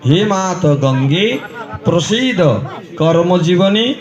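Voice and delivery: slow Sanskrit hymn easing into a humble plea for forgiveness; prayer to Ganga. Acted. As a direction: pray to mother Ganga, then beg pardon.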